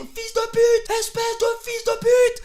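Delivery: high, loud, taunting yell; mocking the beaten driver at full voice; triumphant insult shout. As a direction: scream it while blowing past. Insulting driver while overtaking.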